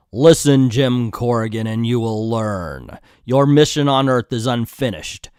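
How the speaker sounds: booming, portentous proclamation; otherworldly voice. Acted. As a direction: intone it like a voice from beyond. Voices otherworldly voice addressing Jim.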